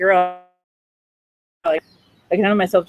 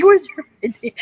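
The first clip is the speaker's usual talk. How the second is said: laughing mock demand; 8 semitones higher than usual.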